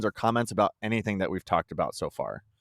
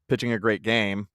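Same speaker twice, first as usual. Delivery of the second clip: ordinary talk, nothing marked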